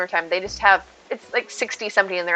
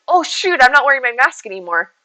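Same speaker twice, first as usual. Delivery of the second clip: high, loud play-acted realisation; mock dismay; 4 semitones higher, 6 dB louder than usual. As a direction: blurt it as if caught out. Acted embarrassed realisation at being caught.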